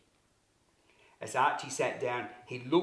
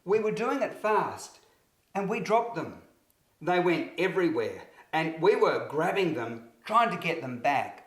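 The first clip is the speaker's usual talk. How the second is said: put-on higher, louder, hurried child; child's voice; 7 semitones higher, 5 dB louder than usual. A narrator's reading. Archie telling Miss Marshall what happened, high and rushed. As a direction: explain it like an upset boy. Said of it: "Archie explained".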